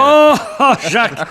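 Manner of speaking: high, loud startled laughing apology; surprised laugh. Acted. Mayor startled by disguised Jack, laughing apology. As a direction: laugh in surprise at not recognising him.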